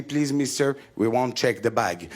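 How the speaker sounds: lightly voiced English security officer; mock polite British agent, low; English airport agent. Airport agent stops him at the scanner, mock English accent. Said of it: "il m'a dit".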